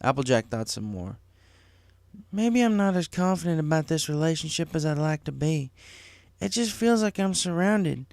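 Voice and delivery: a friend admitting how much she cares; Applejack's voice. Acted. Applejack confiding in Twilight, lightly voiced earnest.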